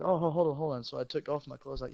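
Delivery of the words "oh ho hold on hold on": quick alarmed "hold on"; startled protest. Retold or imitated. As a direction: stop them mid-swing, half laughing. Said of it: "and I said".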